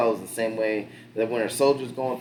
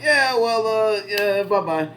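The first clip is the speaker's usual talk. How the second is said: awkward dismissal; 11 semitones higher, 6 dB louder, slower than usual. Retold or imitated. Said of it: "they're just kinda like".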